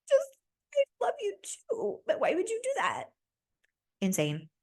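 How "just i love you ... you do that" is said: very high, squeaky mock crying; mock tearful. Contestant answering his "I love you", mock tearful. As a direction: imitate her weepy reply in a tiny high voice. Retold tearful lovers' reply.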